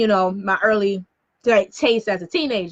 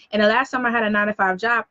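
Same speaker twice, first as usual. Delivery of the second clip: ordinary talk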